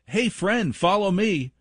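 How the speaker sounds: grown-up mimicking a kid's invitation; creepy adult imitation. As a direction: say it like a grown man trying to sound sweet. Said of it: "If I said".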